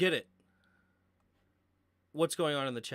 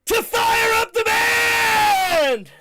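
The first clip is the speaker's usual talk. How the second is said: high, drawn-out celebratory yell; shouted catchphrase; 12 dB louder, much slower than usual. Real, not acted. Announcer calling for the band after a touchdown, shouted. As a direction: belt it out long and loud.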